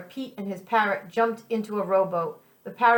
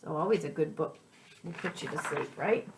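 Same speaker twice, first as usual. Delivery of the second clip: soft aside; 5 semitones lower, 8 dB quieter than usual. Real, not acted. Aside to children, softer.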